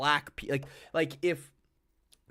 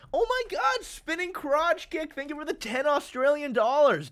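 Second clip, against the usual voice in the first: excited; 11 semitones higher than usual. Real, not acted. Reacting to a donation.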